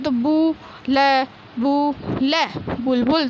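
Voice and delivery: बुलबुल split into बु and ल, then said whole; sounding out syllables. Shown as a demonstration. Teacher to pupils, splitting a word into syllables. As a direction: break बुलबुल into syllables, then join them. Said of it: "तो आपको इस प्रकार पढ़ना है".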